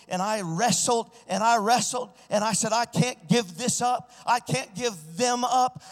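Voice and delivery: pleading, climbing preacher's cry; full-force preaching. Acted. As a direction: preach it like a revival altar call. Preaching testimony, rising intensity.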